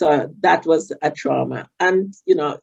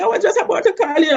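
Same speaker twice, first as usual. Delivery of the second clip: high, strained voice through tears; imitating her own crying, high; retold bawling; faster than usual. To her auntie on the phone, high and tearful. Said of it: "I'm bawling".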